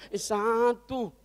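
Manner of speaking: winded, long-stretched strained answer; out of breath. Acted. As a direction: push the word out through exertion. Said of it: "Ini tukang becak ngos-ngosan". (ngos-ngosan, kata diseret panjang)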